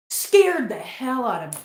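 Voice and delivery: ordinary talk, nothing marked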